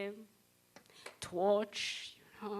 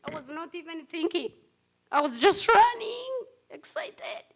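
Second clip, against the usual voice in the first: ordinary talk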